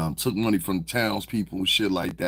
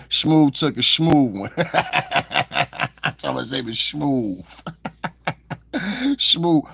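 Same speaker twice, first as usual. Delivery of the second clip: higher, louder, words bursting through laughter; laughing hard; 6 semitones higher, 4 dB louder, much slower than usual. Real, not acted. Streamer teasing someone over the name Smooth, cracking up. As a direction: crack up while getting the joke out.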